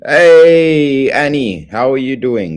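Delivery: big loud hello, pitch way up; excited loud welcome; loud happy greeting. Real, not acted. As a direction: shout a welcome to a returning friend.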